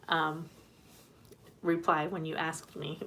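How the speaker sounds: usual speaking voice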